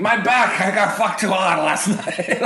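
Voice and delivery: whiny old man playing it for laughs; old Russian twink voice.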